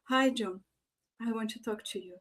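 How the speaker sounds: very soft, subdued request; soft emotion. Shown as a demonstration. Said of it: "you show an emotion".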